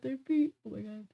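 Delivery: talking through a yawn. Real, not acted. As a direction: yawn between the words. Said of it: "I gotta stop yawning".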